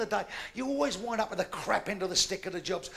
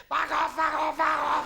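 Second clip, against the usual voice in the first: higher, louder furious yell; berserk yelling; 5 semitones higher, 6 dB louder, slower than usual. Acted. Little nun yelling at birds pecking her seeds, furious. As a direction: scream it at the birds like you've lost it. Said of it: "She went berserk".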